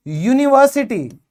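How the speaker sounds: ordinary talk, nothing marked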